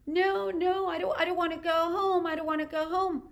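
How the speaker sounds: lightly voiced drunk woman pleading. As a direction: beg not to be taken home.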